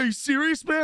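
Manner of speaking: high-pitched mock alarm; retold freak-out. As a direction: imitate a friend losing his mind. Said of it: "he starts losing his mind".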